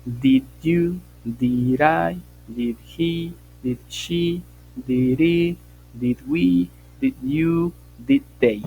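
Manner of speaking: each "did" pair punched loud; classroom drill. Shown as a demonstration. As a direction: chant the pronouns like a drill. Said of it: "drilling subject list".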